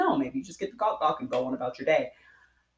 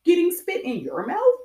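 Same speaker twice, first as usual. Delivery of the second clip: incredulous mock question; 6 semitones higher, slower than usual. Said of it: "conversation of".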